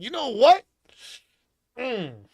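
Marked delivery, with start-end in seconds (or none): 0.0-0.6 high, loud mock comeback; sarcastic high shout; retort. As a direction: fire it back, pitched way up and loud. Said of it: "I was like".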